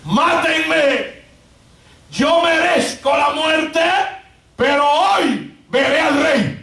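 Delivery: high, drawn-out preacher's cry; declaimed song words. Retold or imitated. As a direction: imitate the condemned man's defiant song as a proclamation. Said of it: "la canción que entonaba decía".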